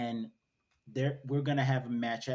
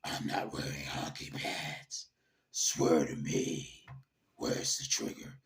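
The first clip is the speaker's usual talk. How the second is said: very low, raspy growl; Batman; 9 semitones lower than usual. Acted. Host doing his best Batman impression, deep gravel growl. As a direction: growl each word deep in the throat. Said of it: "do my best Batman impression".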